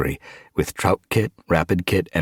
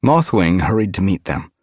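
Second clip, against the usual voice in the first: ordinary delivery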